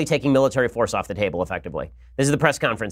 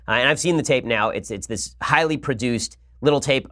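ordinary talk

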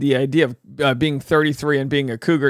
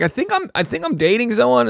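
high hesitant put-on voice; lightly voiced unsure dater; mock unsure guy; 8 semitones higher than usual. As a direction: fumble it like a clueless guy. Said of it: "He's like".

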